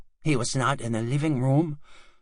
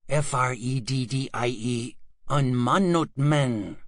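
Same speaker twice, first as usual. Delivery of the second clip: usual speaking voice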